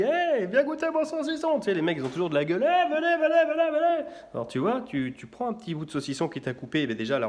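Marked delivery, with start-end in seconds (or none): 0.0-1.6 high, bright hawker's cry; sing-song market vendor hawking sausage; market vendor call. Retold or imitated. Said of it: "Il est là, il dit". 2.7-4.1 high, bright hawker's cry; sing-song market vendor hawking sausage; market vendor call. Retold or imitated. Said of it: "Il est là, il dit".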